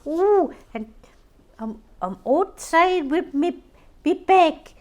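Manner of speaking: like a constipated chicken, high and loud; silly high impression of a friend arriving; imitating Gillian B. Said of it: "She'd go".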